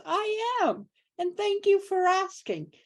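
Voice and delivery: a pleased nurse, pitched well up; voicing the nurse. Nurse quoted in hospital story.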